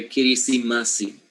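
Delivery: "kiri, sima, si" as kee-ree, see-mah, see; inserted E sounds. Shown as a demonstration. Teacher rebuilding Christmas in Samoan, inserted vowels. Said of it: "that's what happens".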